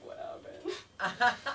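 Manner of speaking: very slow, half-whispered question; mock freak-out. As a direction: stretch it out in hushed comic alarm. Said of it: "I freak out".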